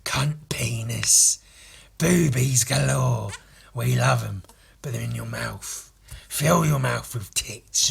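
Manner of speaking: slow whispery rasp, words drawn out; Murdoch. Acted. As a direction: relish each rude word in a cartoon rasp.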